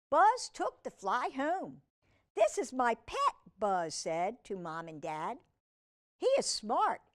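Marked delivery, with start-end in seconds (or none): 2.3-3.3 put-on high, eager boy showing off; boy presenting his pet to Mom and Dad; proud kid voice. Buzz voiced to parents. 6.2-7.0 put-on high, eager boy showing off; boy presenting his pet to Mom and Dad; proud kid voice. Buzz voiced.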